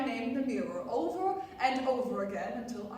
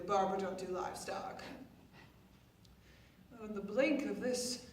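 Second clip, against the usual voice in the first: usual speaking voice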